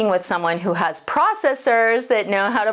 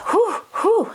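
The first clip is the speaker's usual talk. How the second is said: high puffing exhales, catching breath; breathless whew; 12 semitones higher than usual. Real, not acted. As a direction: blow out air, winded. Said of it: "I'm out of breath".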